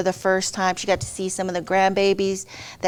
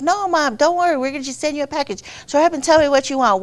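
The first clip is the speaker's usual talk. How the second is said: higher, hurried reassurance; retold impatient coaxing of a mother; insistent reassurance; 5 semitones higher, faster than usual. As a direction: brush off the protest quickly. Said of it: "We're like".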